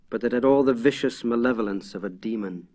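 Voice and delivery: plain reading voice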